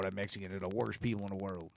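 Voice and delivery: low, rushed mock Southern mumble; Southern accent. Host imitates a bigoted believer, Southern accent. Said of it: "I'm doing a Southern accent".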